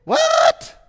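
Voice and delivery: shrieked high What?; a startled king's high cry; exclaimed. A narrator's reading. King of Israel shocked, crying out high. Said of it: "exclaimed the king of Israel".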